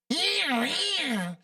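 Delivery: high, falling mewling roar; mock the mascot with a kitten's yowl; cat-like tiger. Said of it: "That's like a cat".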